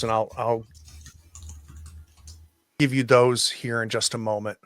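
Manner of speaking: usual speaking voice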